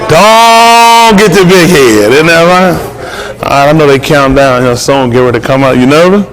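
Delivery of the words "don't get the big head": very slow, stretched warning; drawn-out tease. Acted. Teasing John about his ego, very slow and drawn out. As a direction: stretch every word out slowly. Teasing the groom before wedding.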